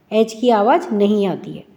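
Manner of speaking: ordinary delivery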